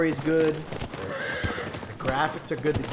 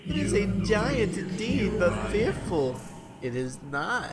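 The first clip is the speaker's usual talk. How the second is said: mock epic narrator; 6 semitones higher than usual.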